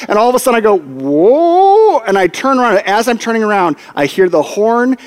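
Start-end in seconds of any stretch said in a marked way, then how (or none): 0.8-2.0 long, swooping slow-motion "whoa"; startled reaction in slowed-down time. Retold or imitated. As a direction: draw it out, dipping then sliding up high. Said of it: "I just slow motion pan".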